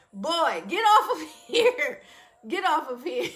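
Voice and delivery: laughing high and loud, mock-shooing him; a laughing, playful get out of here; laughing scold. Real, not acted. Laughing at her husband's teasing comment, playful shoo.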